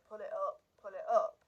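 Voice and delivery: quoting a pilot's shout, half-hearted; retold shouting. Retelling the cockpit recording, a mild mock shout. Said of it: "very clear English accent shouting".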